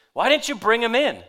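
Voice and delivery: loud, high-pitched demand. A narrator's reading. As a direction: demand an answer from subordinates, loudly. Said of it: "leading priests and Pharisees demanded".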